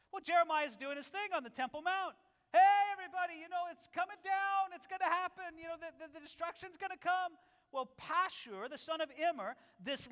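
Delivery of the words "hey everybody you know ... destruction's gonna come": high, loud street-prophet shout; crying out warning. Retold or imitated. Jeremiah crying out warning to the crowd on the Temple Mount, loud. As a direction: call out the doom to the crowd.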